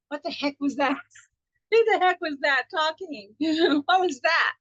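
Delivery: amused mock bewilderment at your own thoughts; baffled inner voice. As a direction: act out a startled thought, laughing between questions. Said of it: "in my mind, I'm going".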